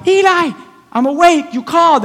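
put-on high-pitched eager boy answering; young Samuel. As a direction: pipe up like a child reporting for duty. Acts young Samuel answering Eli.

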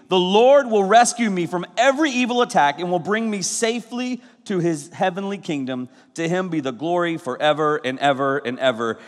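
solemn scripture reading. Acted. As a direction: read the verse with weight. Reads scripture aloud.

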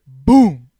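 loud, drawn-out boom; imitated crash boom. Driver retelling the crash to a friend, loud booming impact. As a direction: boom it out like a bumper hit.

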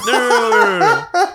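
high, loud laughing no; laughing denial. Real, not acted.

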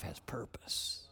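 slow, soft whisper; whispered emphasis. Real, not acted. Preacher closing a point, slow whisper. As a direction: drop to a whisper to drive it home. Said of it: "preacher's quiet point".

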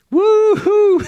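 very high, loud "woo-hoo" breaking into a laugh; exultant high whoop, then chuckle; joyful whoop and laugh. Real, not acted. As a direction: whoop it up in holy joy. Preacher whoops with joy.

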